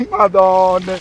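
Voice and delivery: loud high real exclamation; excited exclamation. Real, not acted. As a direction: blurt it out in real amazement. Exclaims at the catch. (esclamazione eccitata)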